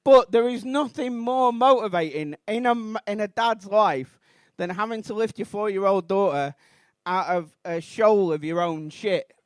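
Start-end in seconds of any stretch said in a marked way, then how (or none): none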